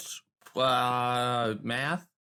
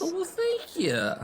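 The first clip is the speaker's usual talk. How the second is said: much higher, sweet polite thank-you. Acted. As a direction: charm the dad with a high voice. Player thanks Dad in character.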